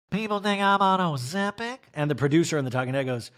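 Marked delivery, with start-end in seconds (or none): 0.1-1.8 lightly mimicked incredulous question; slightly hammed-up, higher-pitched query; Erica. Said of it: "Eric in the talking head goes".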